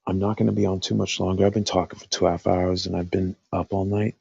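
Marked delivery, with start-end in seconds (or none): none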